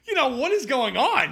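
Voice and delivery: can't stop laughing while asking; laughing out a stunned question; laughing disbelief. Real, not acted. Laughing at the absurd answer.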